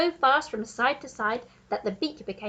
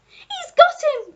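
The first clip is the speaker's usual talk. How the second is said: very high, slow, triumphant monkey shout; higher, louder monkey cry; 9 dB louder, slower than usual. A narrator's reading. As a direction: squeal the news like a thrilled monkey. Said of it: "cried the Monkey".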